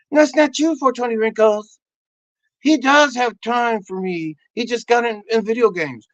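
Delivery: high, insistent wife voice; hammed-up spouse contradicting her husband; arguing wife. Acted wife arguing back.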